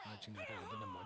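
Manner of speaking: high, soft, knowing aside; sly aside. Real, not acted. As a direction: slip it in under your breath. Sly aside under breath.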